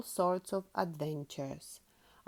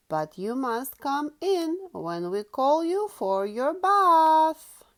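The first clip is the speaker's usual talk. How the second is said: Daddy Pig voice; 5 semitones higher, 6 dB louder than usual. Acted. Daddy Pig sets bath rule.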